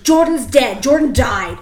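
angry snap. Retold or imitated. Dad breaking news to his kid, imitated loud angry snap. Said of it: "he fucking snaps around".

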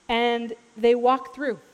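normal talking voice

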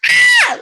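sudden high, loud scream; short piercing imitated yell; mock scream. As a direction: shriek it out, imitating his scream. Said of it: "he starts screaming".

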